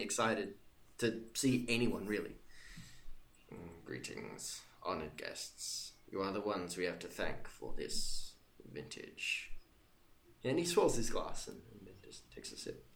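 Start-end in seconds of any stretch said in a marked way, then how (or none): 3.5-9.5 much lower, slow, soft bored nobleman; bored baron. Acted. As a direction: greet guests with weary reluctance, low and slow. GM voices the unexcited Baron.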